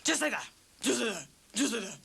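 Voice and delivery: a light Tommy Cooper catchphrase impression, repeated. Friend suggesting a comedy act, doing a light Tommy Cooper. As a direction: lightly mimic the comic's famous line. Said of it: "you could do Tommy Cooper".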